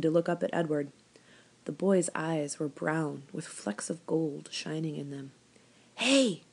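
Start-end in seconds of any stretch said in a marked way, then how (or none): none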